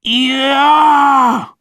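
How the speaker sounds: very high, loud cheer; triumphant kid shout. A narrator's reading. Logan cheering his pretend hit, loud high yell. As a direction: cheer like you just won.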